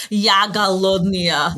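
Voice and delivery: "Ya голодная" said slowly, each syllable placed in Russian; slow, deliberate learner's "Ya голодная"; careful Russian. Shown as a demonstration. Showing off her Russian to the host, slow careful learner. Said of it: "I can say very well".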